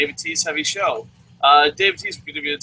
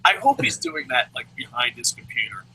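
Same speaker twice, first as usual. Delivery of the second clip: ordinary delivery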